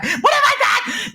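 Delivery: high, drawn-out mock wail; over-the-top cry of despair, stretched out. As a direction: wail it like a hammed-up breakdown. Acting out breakdown on therapist's couch.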